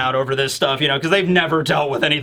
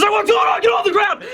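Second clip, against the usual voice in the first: shouting cop. Pretend. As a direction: yell commands like a swat cop. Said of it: "It's like".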